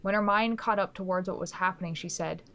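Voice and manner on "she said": usual speaking voice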